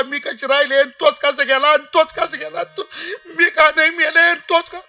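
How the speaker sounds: hammed-up keening lament, rising in pitch; sing-song imitation sobbing; mother's mock wailing. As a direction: wail it like a grieving mother, mimicked. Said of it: "अरे, आई रडायची".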